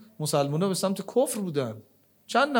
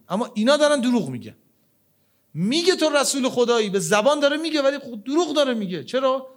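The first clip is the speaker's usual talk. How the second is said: indignant; 7 semitones higher, 4 dB louder than usual. Real, not acted. Preacher's rising indignation at hypocrites.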